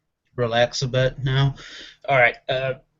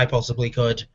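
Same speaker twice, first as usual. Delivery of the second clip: ordinary talk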